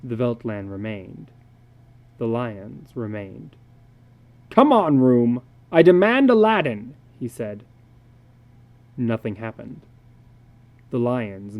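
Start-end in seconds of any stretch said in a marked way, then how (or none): 4.5-6.9 loud, rising angry command; loud frustrated demand to the room. A narrator's reading. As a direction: insist hard.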